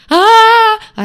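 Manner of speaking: long, very high, loud yell; terrified scream. A narrator's reading. Others scream along with the crocodile, loud scream. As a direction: scream a drawn-out "aaah". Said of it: "орали остальные".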